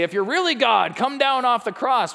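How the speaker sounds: high, loud jeer from the crowd; mocking. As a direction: taunt him, pitched well up and forceful. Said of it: "going to mock him and say".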